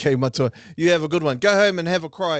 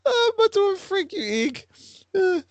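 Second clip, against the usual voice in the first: laughing tease; 14 semitones higher than usual. Real, not acted. Teasing student through laughter.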